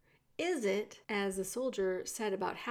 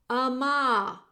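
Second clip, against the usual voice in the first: raised voice to be heard; shouted question; 3 semitones higher, 7 dB louder than usual. A narrator's reading. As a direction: call it out to someone hard of hearing. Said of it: "shouted the soldier".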